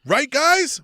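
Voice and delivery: high, loud fishing for approval; mock needy appeal. Mocking Cody asking fans to agree, high and loud.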